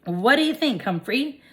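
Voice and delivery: friendly question. A narrator's reading. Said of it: "Mister Fitch asked".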